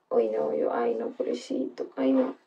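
hushed whispered "pobrecito"; whispered pity. Retold or imitated. Streamer telling chat how sorry she felt, whispered pity. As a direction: drop to a pitying whisper. Pitying the boy softly.

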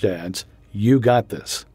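ordinary talk, nothing marked

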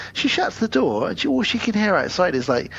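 ordinary talk

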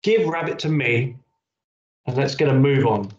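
a mildly cross, rushing mother. A narrator's reading. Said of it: "said Mum crossly".

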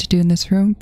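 normal talking voice

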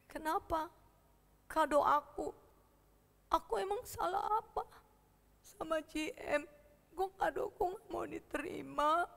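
put-on small, soft, whimpering child; quietly hurt, on the edge of crying; sad, tearful. Boy asks why his gift was refused, sad and tearful. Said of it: "sedih dan nangis".

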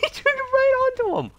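very high excited squeal; shocked squeal. Real, not acted. Gamer watching a fight turn, shocked high squeal. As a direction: squeal it in real disbelief. Sudden shock during gameplay.

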